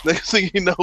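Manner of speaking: ordinary talk, nothing marked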